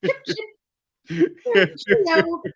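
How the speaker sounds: high-pitched mock British; horrendous British accent. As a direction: do a hammed-up caricature of English speech. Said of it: "my British accent is horrendous".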